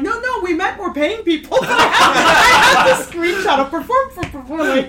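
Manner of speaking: high, loud and breaking into laughter; real laughter running through the words; laughing retelling. Real, not acted. Blogger telling friends of the city's retraction, laughing.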